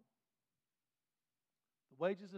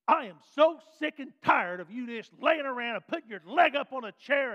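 loud, high, sharp scolding; harsh word; 6 semitones higher, 14 dB louder than usual. Acted. Preacher acting out a harsh word to a lazy listener, loud scolding. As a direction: snap at someone lounging around. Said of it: "a harsh, a harsh word".

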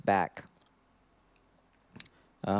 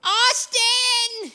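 very high, loud drawn-out scream; piercing over-the-top cry; hammed-up scream; 8 dB louder than usual. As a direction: scream the name out in mock ecstasy. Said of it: "he screamed".

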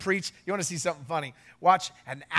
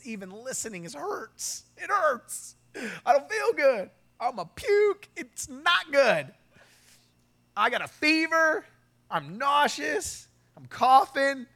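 high-pitched hammed-up complaint; exaggerated pleading, pitched way up; sick, miserable whining; 8 semitones higher, 4 dB louder, slower than usual. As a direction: whine like a sick child begging off. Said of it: "I'm just feeling so terrible".

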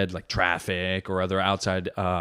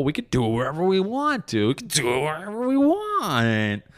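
carried-away boasting; 7 semitones higher than usual. Pretend. Comic bragging about his spontaneous love life, carried away. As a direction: get carried away, stretching the last word high.

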